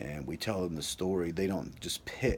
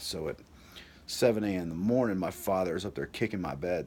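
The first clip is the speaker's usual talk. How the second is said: usual speaking voice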